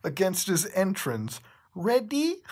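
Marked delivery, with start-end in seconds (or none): 1.7-2.4 higher, put-on Cuphead voice asking if he's ready; a cartoon lover's hammy question; hammed-up Cuphead. Reader voicing Cuphead asking Bendy, higher put-on voice.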